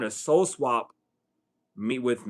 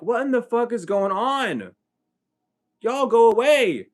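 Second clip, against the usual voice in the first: high, incredulous retold outcry; indignant protest; 10 semitones higher, 5 dB louder than usual. Child to preacher barging into the house, indignant protest. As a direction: push them away, raised pitch and voice.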